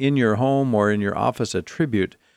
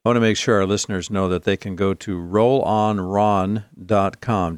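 ordinary talk

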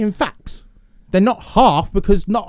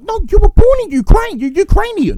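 high, louder mock objection; baffled protest; 13 semitones higher, 5 dB louder than usual. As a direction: protest in high-pitched disbelief.

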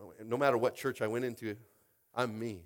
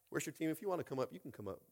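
ordinary talk, nothing marked

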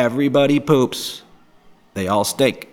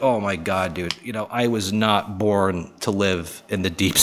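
normal talking voice

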